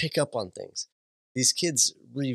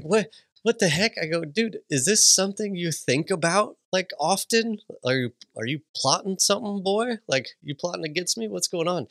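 mock alarm; 8 semitones higher than usual. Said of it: "I was like".

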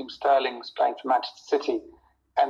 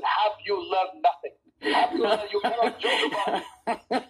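mock scolding; 5 semitones higher, slower than usual. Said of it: "because they said".

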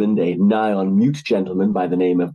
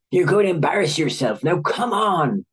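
stern mother snarl; 8 semitones higher than usual. A narrator's reading. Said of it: "snarled under her breath".

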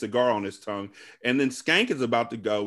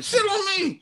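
high, squeaky mock yell; squeaky catchphrase.

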